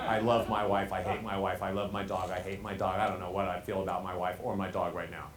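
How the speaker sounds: low one-note drone, every feeling alike; monotone. Acted. Coach mimics speaker torn over wife and dog, monotone. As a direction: deliver love and hate identically on one low note. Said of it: "because it's the same melody".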